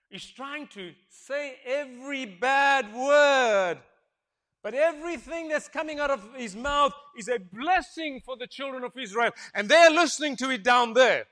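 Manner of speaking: slow start, loud and high; preaching climax. Real, not acted. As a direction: belt the sermon climax.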